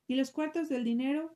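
usual speaking voice

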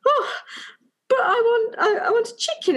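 put-on high, crying child's whine for chicken; whiny child. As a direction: wail like a disappointed ten-year-old. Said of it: "And he went".

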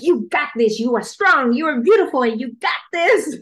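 cheering yourself on like a coach; pep talk. Retold or imitated. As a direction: pump yourself up in the mirror. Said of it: "give myself a pep talk".

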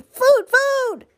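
very high, loud squeal; hungry gosling peep. A narrator's reading. Hungry gosling begging the robot, very high peep. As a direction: demand it like a famished baby bird. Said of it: "That tiny voice".

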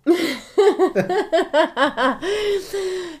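loud, high burst of laughter; real laugh. Real, not acted. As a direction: burst out in a long giggling laugh.

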